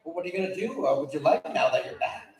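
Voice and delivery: ordinary talk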